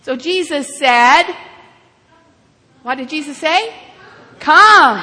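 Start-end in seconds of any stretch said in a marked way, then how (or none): 4.4-5.0 a single loud, commanding call. Acted. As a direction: call it out firmly and loudly. Preacher voices Jesus's spoken command.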